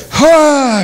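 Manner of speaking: sudden high loud call over the storm; loud cry out. Acted. Rising in the storm, a sharp loud call to silence the wind. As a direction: raise the voice sharply to command the storm.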